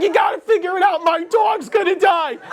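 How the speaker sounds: high, fast frantic shout; panicked yell. Retold or imitated. Owner begging him to save her dog, high frantic shout. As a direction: scream for help as the desperate owner.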